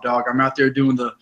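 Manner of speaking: ordinary talk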